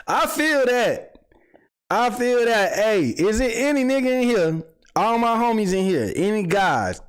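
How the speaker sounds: high, excited calling to chat; hyped call-out. Real, not acted. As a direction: shout out to the room.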